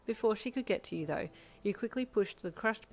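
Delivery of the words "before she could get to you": plain reading voice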